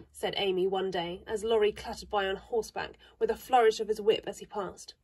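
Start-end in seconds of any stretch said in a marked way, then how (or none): none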